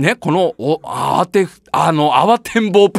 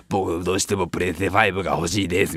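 put-on very low, quiet stubborn kid; insisting in a small voice; child voice; 8 dB quieter than usual. (小声でねだる子供)

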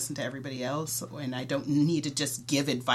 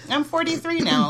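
excited insistence; 13 semitones higher, 8 dB louder than usual. Real, not acted. Laughing insistence on age.

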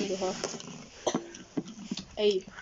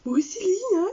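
aunt voice; 9 semitones higher, 4 dB louder than usual. Retold or imitated. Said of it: "und sagt".

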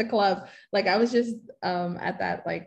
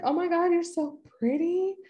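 high-pitched mock gushing; 10 semitones higher than usual. Woman complimenting girls in the brunch bathroom, gushing. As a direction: gush over a pretty stranger.

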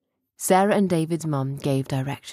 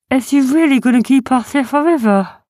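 high, loud anxious question; worried little creature; 9 semitones higher, 9 dB louder than usual. Acted. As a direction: ask in a small creature's alarmed voice.

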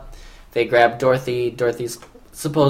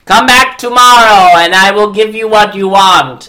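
loud, higher mock decree; mock wizard; 7 semitones higher, 10 dB louder than usual. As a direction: announce like the wizard. Voicing the wizard.

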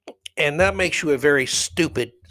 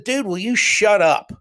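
exasperated, raised-pitch demand; retold angry outburst; 6 semitones higher, 4 dB louder than usual. Fed-up spectator to a heckling fan, angry. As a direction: tell him off, fed up. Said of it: "I couldn't believe I was that angry".

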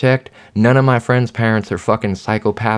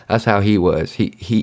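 normal talking voice

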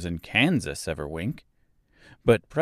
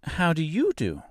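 put-on high, modest girl's greeting; light, courteous Dorothy voice; polite little girl; 9 semitones higher than usual. A narrator's reading. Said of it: "replied Dorothy politely".

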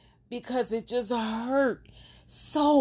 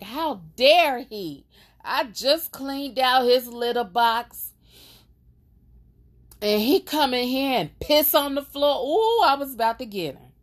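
mock angry; 5 semitones higher, 4 dB louder than usual. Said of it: "I was getting angry".

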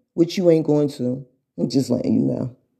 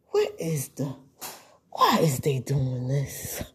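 slow, whispered wondering; puzzled whisper; 8 dB quieter than usual. Retold or imitated. Onlooker wonders why she is attacked, whispering. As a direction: murmur it like a baffled onlooker.

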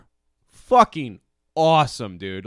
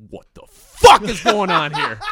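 raised, louder disbelief breaking into laughter; baffled viewer; 4 semitones higher, 7 dB louder than usual. Retold or imitated. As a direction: act out stunned confusion, laughing.

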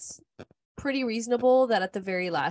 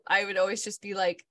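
ordinary delivery

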